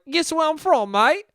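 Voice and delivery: high, badly faked British-Australian accent; muddled mock accent. Foreign dude quizzing a stranger, muddled high British-Australian accent. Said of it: "and they'll be like".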